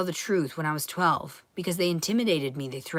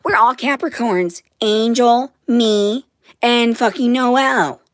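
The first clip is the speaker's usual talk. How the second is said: loud, high mock of mom gushing; mock her gushing about star signs; 4 semitones higher, 8 dB louder, slower than usual. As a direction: daughter mocks mom over astrology, loud and high. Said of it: "And she was like".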